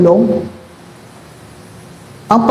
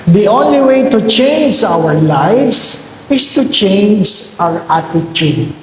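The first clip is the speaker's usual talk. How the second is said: slow emphatic quote; slower than usual. Acted. Priest stating key line slowly.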